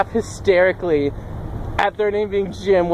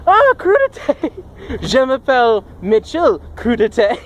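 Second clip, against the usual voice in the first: hammed-up French speaker; 4 semitones higher than usual. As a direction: introduce yourself in over-the-top French. Joke French greeting using the word.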